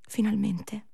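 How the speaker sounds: ordinary talk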